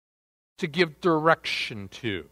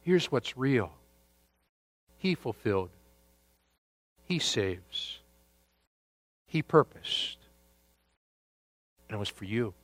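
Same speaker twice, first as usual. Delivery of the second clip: quiet, lower and gentle, speaking to one person; soft, tender appeal; 5 semitones lower, 7 dB quieter than usual. Acted. Preacher speaking to the Elvises of this world, soft and tender. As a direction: hush down and plead gently. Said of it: "and say".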